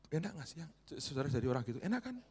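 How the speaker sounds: high, loud, driving exhortation; fiery preaching. Acted. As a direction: drive the appeal home.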